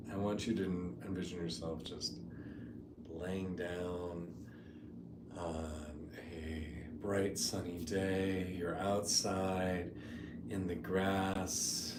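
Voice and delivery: soft, unhurried meditation voice with long pauses; guided meditation. Real, not acted. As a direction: ease them gently into the imagery.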